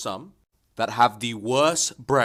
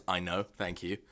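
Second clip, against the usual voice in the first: playing it cool; 7 semitones lower, 11 dB quieter than usual. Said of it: "plays it off cool and says".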